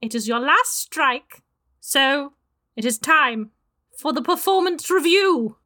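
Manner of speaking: high, bossy witch voice; witchy voice. Acted. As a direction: lay down the law as a witch.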